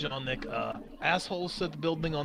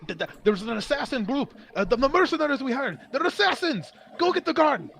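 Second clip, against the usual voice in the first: panicked; 7 semitones higher, 5 dB louder than usual. Acted. Panicked warning after assassin attack.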